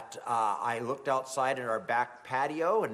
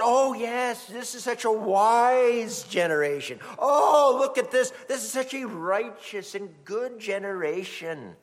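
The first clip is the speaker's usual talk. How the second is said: high, loud sarcastic praise that fades off toward the end; overdone sarcastic flattery; mock flattery; 6 semitones higher, 4 dB louder than usual. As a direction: gush with fake admiration. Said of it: "Jesus doesn't flatter that generation".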